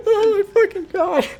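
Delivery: high, breathless real laughter; real laughter breaking through the words; laughing hard. Real, not acted. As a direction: crack up at your own story. Laughing at his own story.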